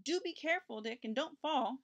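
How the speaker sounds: high-pitched, mildly anxious warning. A narrator's reading. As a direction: caution someone, starting high and easing down. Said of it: "warned Richard's mother".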